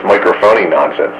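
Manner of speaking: ordinary delivery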